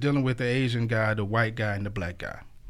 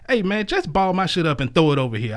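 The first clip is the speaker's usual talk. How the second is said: loud punchline; 6 semitones higher, 6 dB louder than usual. Acted.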